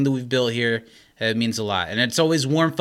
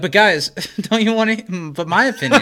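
mock whiny voice, laughing; 6 semitones higher, 3 dB louder than usual.

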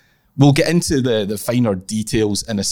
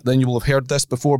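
normal talking voice

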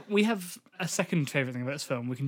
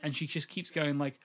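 ordinary delivery

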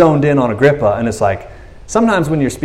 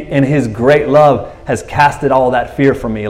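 normal talking voice

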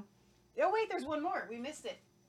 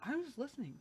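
mouth full; 3 semitones higher, 10 dB quieter, faster than usual. Real, not acted. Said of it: "please don't talk with your mouth full".

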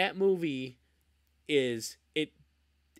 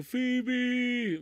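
mock dramatic wail of a name; imitated movie cry; 10 semitones higher than usual. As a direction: cry out the name as if losing her. Quoting the film's cry.

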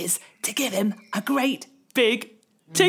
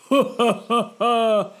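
wolf's laugh; 3 dB louder than usual. Acted. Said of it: "he thought".

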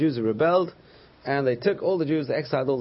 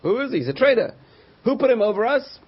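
indignant, higher-pitched challenge; indignant objectors; 11 semitones higher, 5 dB louder than usual. Retold or imitated. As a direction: protest like an outraged crowd of doubters.

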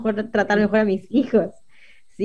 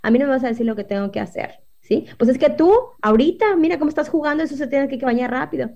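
authoritarian parent; 5 semitones higher, faster than usual. Retold or imitated. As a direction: snap at the child to hurry up. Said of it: "otros papás ... autoritario dirían".

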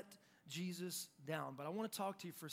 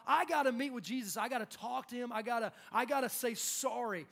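loud, higher, urgent insistence; intensely passionate; 4 semitones higher, 6 dB louder than usual. Retold or imitated. Peter desperate to reach Jesus and apologize, passionate. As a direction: press it out eagerly, tripping over repeats. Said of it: "intensely passionate about".

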